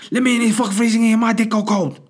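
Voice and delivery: put-on high, fast, loud accented dog yell; mock Chinese accent, yelling. Freezing dog at door, frantic yelling in mock Chinese accent. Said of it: "This is the way I talk".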